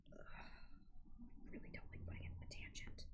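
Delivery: muttered aside while working; whispered aside. Real, not acted. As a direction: whisper it to yourself.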